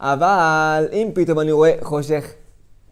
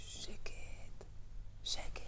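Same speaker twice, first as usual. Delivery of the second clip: hushed whisper; 24 dB quieter, much slower than usual. Acted. Preacher hushing the people at the cemetery, whispered. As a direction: breathe it out, silence everyone.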